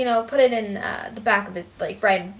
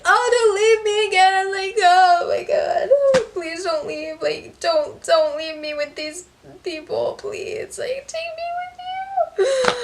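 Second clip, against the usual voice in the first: high, loud, tearful begging, much higher than her own voice; retold crying plea; 10 semitones higher, 6 dB louder, slower than usual. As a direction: wail it like a little kid begging dad to stay. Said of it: "I was like".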